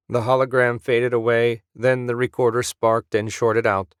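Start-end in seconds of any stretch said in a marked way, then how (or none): none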